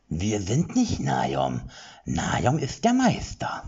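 a deep voice that doesn't fit the body; deep creature voice. Acted. Second Upkolupke correcting Erik, slow oddly pitched. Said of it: "welche ungemein tiefer klang".

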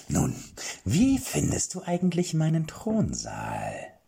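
high, slow whispered question; mad old king. Acted. Mad king asks the knight about his throne room. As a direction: ask proudly like a crazed host.

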